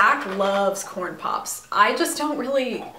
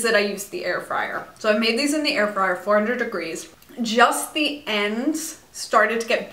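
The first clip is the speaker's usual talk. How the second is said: speaking between bites; chewing while talking. Real, not acted. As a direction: mouth partly full of food. Seen 2: holds fry, bites it at +1.2, talks between bites.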